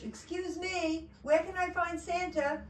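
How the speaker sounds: high-pitched storybook turkey; high, polite call for help; turkey asking directions. Acted. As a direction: pipe up high to get attention. Turkey calls out to elf.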